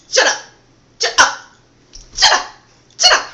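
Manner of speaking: loud, high laughing "shut up"; amused shout. Real, not acted. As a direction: yell it in delighted disbelief. Laughing disbelief after performance.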